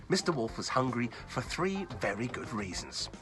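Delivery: usual speaking voice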